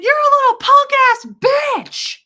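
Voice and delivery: very high, loud retaliating shout; yelled insult. Retold or imitated. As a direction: scream it back at the man. Yells insult back at screaming man.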